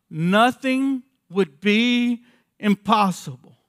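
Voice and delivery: "Nothing" punched loud with a falling tune, then slow and loud to the end; slow emphatic line. Shown as a demonstration. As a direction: lean hard on "Nothing" and draw out every word. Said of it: "Now listen to this next line".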